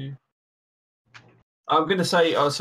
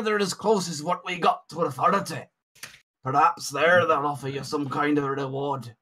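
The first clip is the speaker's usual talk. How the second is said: put-on drifting Scottish accent; 2 semitones higher than usual. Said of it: "my accent's changing a bit more".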